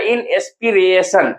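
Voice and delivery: "inspiration" in separate syllables, in-es-pi-re-shan; broken into syllables. Shown as a demonstration. Teacher breaking up the word, "inspiration" slow and segmented. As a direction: spell out the sound of "inspiration" piece by piece. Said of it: "इसको तोड़ के जब लिखेगा".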